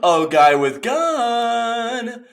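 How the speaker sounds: high, drawn-out hammed-up call; theatrical high-pitched plea; mock summoning call. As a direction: call out slowly to a faraway rescuer.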